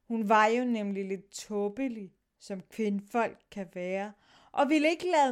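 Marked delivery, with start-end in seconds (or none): none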